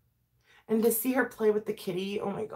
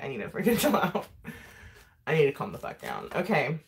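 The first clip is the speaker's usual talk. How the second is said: ordinary talk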